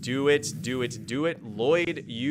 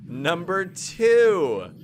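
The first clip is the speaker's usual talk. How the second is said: louder, slow, drawn out; excited count; 4 semitones higher, 8 dB louder, much slower than usual. Real, not acted. Streamer counting ultimate attacks, louder and drawn out. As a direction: stretch the count in delight.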